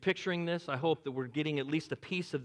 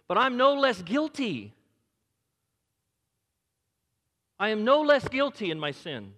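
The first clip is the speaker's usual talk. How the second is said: higher, louder preacher climbing in fervor; fervent preaching; 6 semitones higher, 6 dB louder than usual. Acted. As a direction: preach it with rising fire. Preaching at full force.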